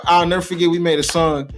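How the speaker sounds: ordinary talk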